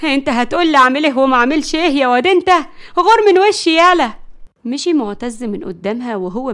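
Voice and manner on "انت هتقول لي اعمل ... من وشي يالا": fast scolding building to a loud shout; exasperated. A narrator's reading. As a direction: chase the boy off angrily. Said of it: "وهي بتقول بغيظ". (بغيظ وزعيق)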